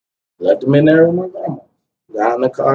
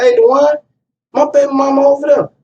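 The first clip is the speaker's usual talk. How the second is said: high, pressing urgent voice; urgent call; 10 semitones higher than usual. Retold or imitated. Man calling his friend about his son's mother, urgent and high. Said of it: "I was like".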